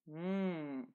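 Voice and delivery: "M" as a sustained hum; letter's sound. Shown as a demonstration. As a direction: hum "M" with no vowel before it. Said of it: "sound M".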